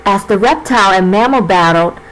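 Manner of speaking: normal talking voice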